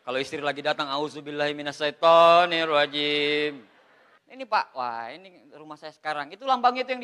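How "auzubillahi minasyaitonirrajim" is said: mock prayer. Joke: praying when his wife arrives.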